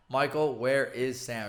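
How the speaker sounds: slow, loud, word-by-word insistence; mock exasperated demand. Sister not buying the excuse, slow exasperated mock demand. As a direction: imitate a sister out of patience, drawing it out. Sister asks again, losing patience.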